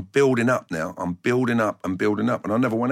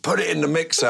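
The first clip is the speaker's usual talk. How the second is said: higher, mock yelled call across the pitch; shout to a teammate; 6 semitones higher than usual. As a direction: call it out to the winger. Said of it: "we'd give it the shout to Dave Besson".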